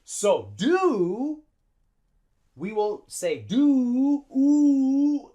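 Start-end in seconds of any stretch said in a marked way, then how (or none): 3.4-5.3 "do" with a long, drawn-out "oo"; overlong vowel. Shown as a demonstration. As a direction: stretch the vowel of "do" high and long. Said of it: "oo is too long".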